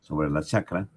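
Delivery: ordinary delivery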